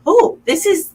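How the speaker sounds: a lightbulb moment, voiced high; excited realization. Remembering her own thrill at finding collage, high and excited. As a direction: jump up high with sudden delight. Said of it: "I was like".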